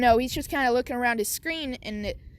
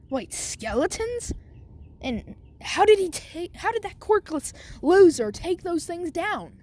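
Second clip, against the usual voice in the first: Bakugo; 6 semitones higher than usual. Acted. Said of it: "Bakugo's just watching. He's like".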